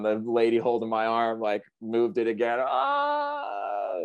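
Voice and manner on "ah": sudden high sharp cry of pain; pained yell. Retold or imitated. Surfer reliving the rescuer moving his arm, pained yell. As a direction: yelp out as the arm is moved.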